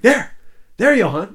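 high, loud call of there, there. Retold or imitated. Crew member calling out to Johan, loud high call. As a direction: point and shout it across the deck.

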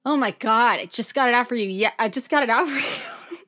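ordinary talk, nothing marked

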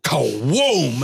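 big drawn-out kaboom; imitated gunshot. Storyteller imitating the point-blank shot, booming gunshot noise. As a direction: boom it out like a shotgun blast.

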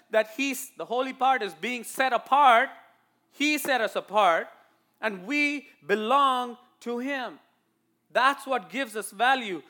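high, loud, insistent sermon; forceful preaching. Real, not acted. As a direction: drive the point home to the church. Preaches to the congregation.

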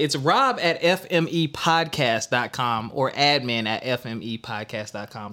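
ordinary delivery

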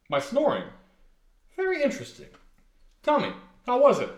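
[name] teasing Elliot about his snoring; mock curiosity. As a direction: feign great interest in his snoring.

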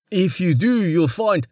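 bowing, eager-to-please commoner; humble weaver. A narrator's reading. Wes pleads, cut off.

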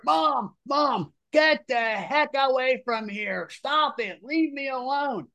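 put-on high, slow, pleading teen voice; begging mom to leave, mortified; embarrassed son. Embarrassed son begs his mom to get away, high and slow. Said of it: "The son comes running out".

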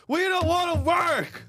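high, loud mock yell of protesters; mock French protester shout. Friend mocking French protesters with a high shout. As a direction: shout it like a striking French worker. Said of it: "They're all out there".

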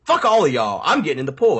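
huffy exit line; storming off. Retold or imitated. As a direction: announce it as you stomp away. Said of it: "I was fucking mad".

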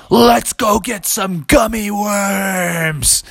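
very slow, drawn-out big voice; slow dinosaur voice. Acted. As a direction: lumber through each word like a giant. T-Rex speaks slowly.

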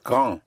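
French "grand" ending on a nasal vowel; "grand" with no D sounded; French, silent final D. Shown as a demonstration. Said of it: "G R A N D would be pronounced".

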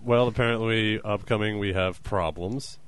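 normal talking voice

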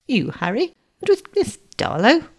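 astonished question climbing high; surprised cry. A narrator's reading. Uncle finds his nephew with Miss Darlow, astonished. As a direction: query it in rising disbelief. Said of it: "cried Mister Benjamin Parker".

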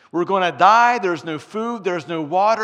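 loud, frantic panicked yell; mock scream. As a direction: shout it like a man sure he'll die. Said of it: "one of them started screaming".

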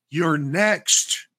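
retold exasperation, slow and high; exasperated insistence. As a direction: explain it to someone who won't listen. Acted exasperated reply to drunk customer.

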